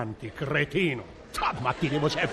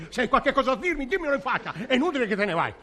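indignant; 7 semitones higher, faster than usual. Acted. Comic quarrel, confronting.

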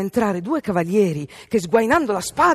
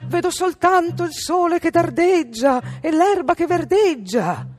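high, slow sing-out reply; sister calling down; 9 semitones higher than usual. A narrator's reading. Sister Anna answers from the tower, calling down. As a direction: call back from far off, stretching the words.

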